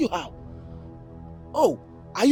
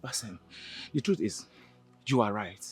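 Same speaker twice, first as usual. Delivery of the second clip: soft admission; 10 semitones lower, 17 dB quieter than usual. Acted. Admitting guilt softly.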